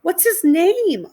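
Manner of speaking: high, slow cooing lady voice; sweet woman. A narrator's reading. Woman cooing at a puppy, high and slow. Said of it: "a woman asked".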